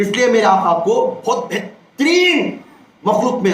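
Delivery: ordinary talk, nothing marked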